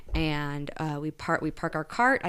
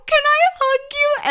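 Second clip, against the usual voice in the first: much higher and louder gushing question; elated relief; 8 dB louder than usual. Retold or imitated. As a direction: gush it out, overjoyed with relief. Relieved after finding purse.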